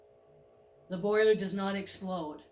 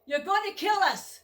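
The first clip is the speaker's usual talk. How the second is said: much higher and louder frantic yell; panicked shout; 10 semitones higher, 8 dB louder than usual. Acted. Goat yells at the speeding driver, panicked shout. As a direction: scream at the driver to stop. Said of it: "The goat".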